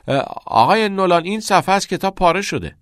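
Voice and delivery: high, quick schoolboy voice; schoolboy's excuse. A narrator's reading. Cameron excuses himself to teacher Nolan, high and quick.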